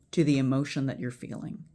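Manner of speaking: usual speaking voice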